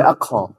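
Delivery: "akho" with an airy k release; breathy qaf. Shown as a demonstration. Teacher repeating the forbidden form, aspirated qaf. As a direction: let air escape on "akho". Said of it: "Nggak boleh akho".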